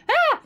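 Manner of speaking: high, loud startled "Ah!"; mock scream. Gaming scare retold to viewers, high mock yelp. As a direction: yelp like a monster jumped you. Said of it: "something scary in Elden Ring".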